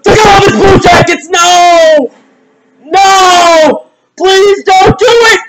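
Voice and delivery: high, wailing shout; panicked pleading. Real, not acted. Fan dreading the result, panicked pleading. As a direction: cry out high, begging it not to happen.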